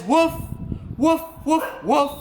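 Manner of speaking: woofing bark; barking dog. Acted. Animal song, dog sound.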